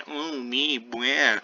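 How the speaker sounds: high-pitched mocking whine; mock whining. Teacher mimics Juliet crying to her father, mock whining. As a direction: whine it, making fun of her self-pity. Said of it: "who's crying, whining, and saying".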